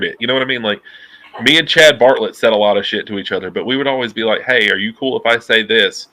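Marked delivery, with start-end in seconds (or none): none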